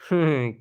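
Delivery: a short burst of real laughter. Real, not acted. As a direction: laugh you can't hold back. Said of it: "हंसी भी नहीं रुक रही है मेरी तो".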